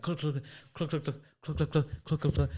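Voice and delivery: high, rapid clicking noises; mimicked battery clicks. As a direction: imitate the batteries rattling. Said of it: "I'm like".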